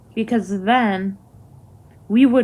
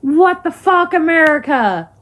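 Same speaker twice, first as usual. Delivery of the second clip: louder, higher outraged cry; furious; 5 semitones higher, 5 dB louder than usual. Real, not acted. Ranting vlogger yelling at America, furious. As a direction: throw the outrage at the whole country.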